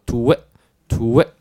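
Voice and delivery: トゥエ as one quick "tweh", puckered then released; T-W-short I blend. Shown as a demonstration. Teacher joining the sounds for learners, loosened short vowel. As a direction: glide from pursed lips into slack トゥエ. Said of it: "いに持っていくと".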